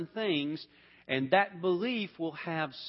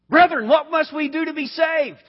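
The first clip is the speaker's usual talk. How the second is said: desperate plea; 12 semitones higher, 12 dB louder than usual. Acted. Jailer's desperate plea in Acts.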